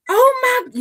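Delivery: high, loud joyful cry of surprise; excited family cry. Retold or imitated. Relatives shout as the nephew walks in, high and loud with joy. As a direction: shriek it like relatives seeing him after years.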